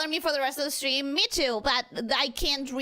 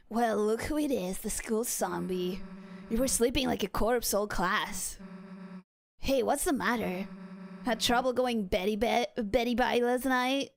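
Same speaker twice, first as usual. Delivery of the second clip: low, lazy teasing voice; low mocking schoolmate; teasing classmate voice; 4 semitones lower than usual. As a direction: tease him from lower down. Voicing game character teasing.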